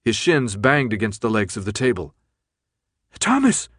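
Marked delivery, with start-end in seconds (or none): 3.2-3.7 high-pitched cry of alarm; alarmed yell. A narrator's reading. Brenda to Thomas as he convulses, yelling high. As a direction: call the name out in sudden alarm. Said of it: "Brenda yelled".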